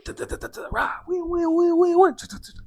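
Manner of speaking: mouth-made police siren, wailing up high and loud; siren imitation. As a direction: mimic a siren rising to a wail. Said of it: "I should really invest in a sound effects machine".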